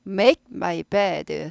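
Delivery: 단어마다 끊어서 천천히 make my bed; staccato. Shown as a demonstration. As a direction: make my bed, very slow and word by word. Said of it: "스타카토가, 예, 고급입니다". (초보식 스타카토 발음)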